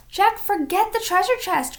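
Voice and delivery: high urgent call; cried. A narrator's reading. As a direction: plead with Jack to come. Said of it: "cried Annie".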